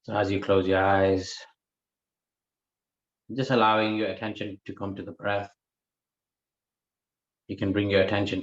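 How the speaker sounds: measured meditation guidance with long pauses; guided meditation. Real, not acted. Teacher guiding listeners to watch the breath, unhurried guided meditation. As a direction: guide the breath phrase by phrase, leaving space.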